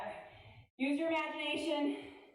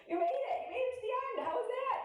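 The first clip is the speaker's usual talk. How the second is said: high, bright-voiced praise for finishing; excited cheer; 10 semitones higher than usual. Real, not acted. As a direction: coach cheering the class at the finish line. Celebrating end of workout.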